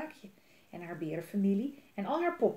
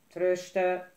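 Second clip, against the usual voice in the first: grumbled goodnight; 7 dB louder than usual. A narrator's reading. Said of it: "gromde hun vader terug".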